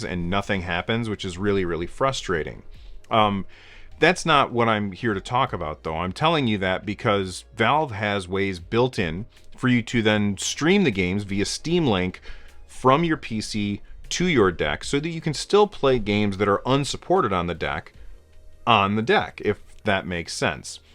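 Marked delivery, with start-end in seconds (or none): none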